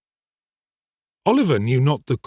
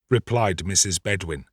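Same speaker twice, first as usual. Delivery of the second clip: usual speaking voice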